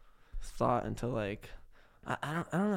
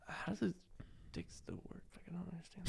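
hushed whisper; 6 semitones higher, 15 dB quieter, faster than usual. Real, not acted. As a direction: drop to a whisper. Embarrassed aside about Nick Cannon.

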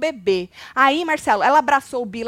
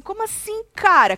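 "Como assim" bem mais agudo, incrédulo; espanto incrédulo; 9 semitones higher, 4 dB louder than usual. Retold or imitated. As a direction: imita a Yasmin chocada, voz subindo alto. Imitando a reação da Yasmin. (Yasmin reagindo à colega, espanto agudo)

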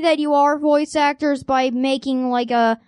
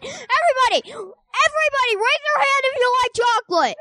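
calling out; 9 semitones higher, faster than usual. Real, not acted. Kid calling out to audience.

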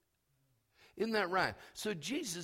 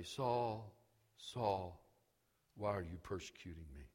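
low, quiet and quick, no emphasis; deliberately unemphatic, soft low voice; understated call; 10 semitones lower, 10 dB quieter than usual. Shown as a demonstration. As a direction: murmur it low, as if it hardly matters. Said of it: "he doesn't say".

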